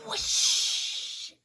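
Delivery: long, rushing whoosh; owl whoosh. Shown as a demonstration. Leading children in making the sound, long whoosh. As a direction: stretch the whoosh out like wind. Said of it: "Can you make that sound with me?".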